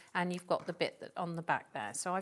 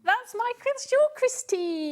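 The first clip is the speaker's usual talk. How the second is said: high, loud and drawn out with delight; excited squeal; 10 dB louder, slower than usual. Real, not acted. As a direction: squeal the name happily. Excited recognising a caller's name.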